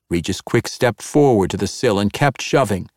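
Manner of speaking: ordinary delivery, nothing marked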